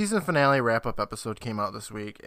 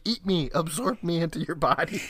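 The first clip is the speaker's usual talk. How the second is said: higher-pitched pleading bug voice; voiced flies; 5 semitones higher than usual. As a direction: play a fly begging to be eaten. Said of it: "They're like".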